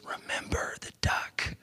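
breathy unvoiced whisper, very soft. A narrator's reading. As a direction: hiss the threat under her breath. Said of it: "Sarah whispers".

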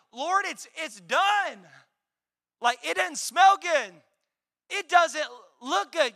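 high, loud acted objection; exclaimed protest. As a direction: protest emphatically as the doubting sister. Acts Martha protesting to Jesus.

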